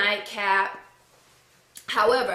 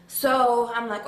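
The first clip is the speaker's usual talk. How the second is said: plain reading voice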